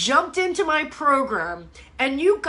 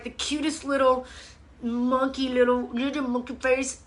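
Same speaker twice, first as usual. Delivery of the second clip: ordinary talk, nothing marked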